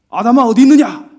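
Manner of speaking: loud, high angry call of Adam's name; angry shout. Shown as a demonstration. Preacher mimics an angry God calling Adam, angry shout. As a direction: bellow it like an enraged God. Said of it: "우리는 어떻게 읽냐면".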